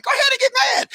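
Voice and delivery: very high-pitched goading cry; egging on. Acted. Emotion urging mad on, high goading voice. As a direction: squeal the dare. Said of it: "emotional be like".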